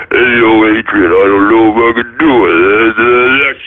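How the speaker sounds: low, defeated Rocky to Adrian; Rocky impression. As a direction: drop way down and mumble like a beaten boxer. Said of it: "I wouldn't have been like Rocky".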